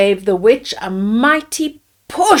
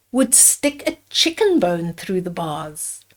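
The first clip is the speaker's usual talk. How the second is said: plain reading voice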